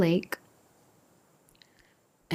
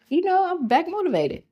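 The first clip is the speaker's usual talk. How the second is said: suddenly upbeat; 11 semitones higher, 4 dB louder, faster than usual. Retold or imitated. Regaining courage after auntie's call.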